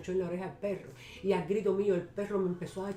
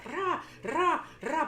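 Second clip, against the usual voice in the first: high, forceful "ra" bursts; imitated banging noise; 8 semitones higher, much slower than usual. Woman showing how she whacked the little camera, mock-violent noise. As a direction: sound out hard whacks in sharp, high bursts.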